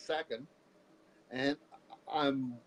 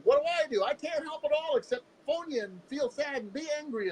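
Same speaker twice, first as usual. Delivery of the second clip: high-pitched retold helpless anger; retold frustrated outburst of a worried parent; imitating upset father; 11 semitones higher than usual. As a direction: voice a dad's exasperated pleading, raised in pitch. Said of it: "he was pretty angry".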